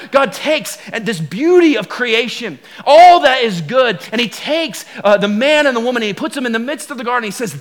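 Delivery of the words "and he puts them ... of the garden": usual speaking voice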